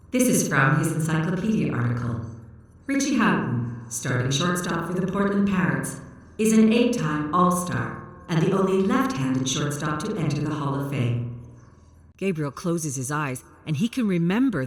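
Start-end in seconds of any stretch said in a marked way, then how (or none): 0.1-11.4 louder, even machine voice reciting facts; robot unit voice. Acted. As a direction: read it out like a literal-minded robot. Computer reads encyclopedia article.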